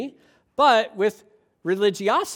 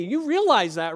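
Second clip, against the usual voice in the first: usual speaking voice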